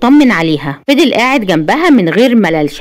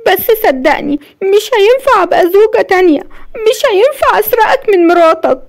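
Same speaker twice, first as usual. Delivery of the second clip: much higher, acted weeping voice; tearful pleading; 9 semitones higher than usual. A narrator's reading. Woman begs Asem for divorce, high tearful plea. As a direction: plead through tears, pitch climbing high. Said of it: "وقالت له ودموعها نازلة بغزارة".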